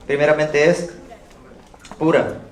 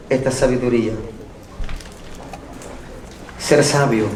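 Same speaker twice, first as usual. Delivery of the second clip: preaching at full force. Acted. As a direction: urge the flock to seek wisdom from above. Preaching louder over music.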